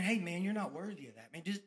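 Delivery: a low, soft voice in a different tone, sneering; the doubting voice on his shoulder, low and soft; tempting inner voice. Retold or imitated. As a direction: murmur it low like the devil on your shoulder. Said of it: "that other shoulder talking to me saying".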